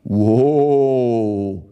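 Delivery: long, loud drawn-out exclamation; stoner intoning. A narrator's reading. Otto watching the fight on his bong, stoner intoning. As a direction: stretch the word out in stoned wonder. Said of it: "tont Otto".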